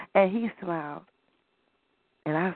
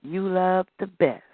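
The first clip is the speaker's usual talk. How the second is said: usual speaking voice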